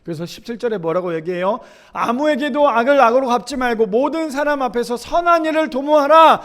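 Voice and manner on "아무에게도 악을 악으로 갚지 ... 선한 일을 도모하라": high, loud pulpit reading; scripture proclamation. Acted. Preacher reads verse seventeen to the congregation, proclaiming loudly. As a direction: build to a forceful final command.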